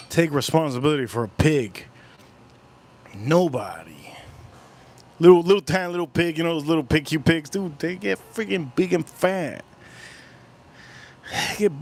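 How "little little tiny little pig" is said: ordinary delivery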